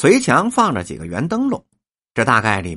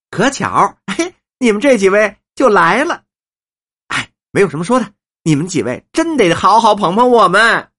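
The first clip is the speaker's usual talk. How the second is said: high, emphatic appeal, swelling louder; eager old woman, coaxing; 6 semitones higher, 4 dB louder than usual. Acted. Old woman asks guests to back the actors, eager and coaxing. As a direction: plead for patronage, high and effusive.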